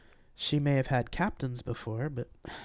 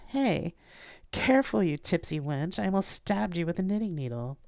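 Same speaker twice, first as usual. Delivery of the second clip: higher playful scolding woman; laughing mock scold; Mandy's voice; 7 semitones higher than usual.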